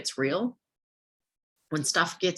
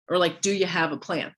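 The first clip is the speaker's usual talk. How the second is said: usual speaking voice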